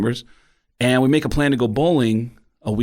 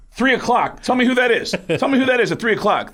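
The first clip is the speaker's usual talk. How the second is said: urgent excitement; 13 semitones higher than usual. Retold or imitated. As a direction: make them look right now. Urging friends to look.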